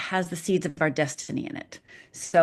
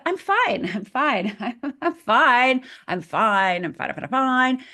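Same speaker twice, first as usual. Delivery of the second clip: chirpy denial; 6 semitones higher, slower than usual. Pretend. Performing outside self's denial.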